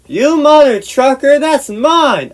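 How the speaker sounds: high, loud indignant shout; imitated furious cousin yelling; outraged yell. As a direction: explode at the thief. Cousin's outburst on hearing it.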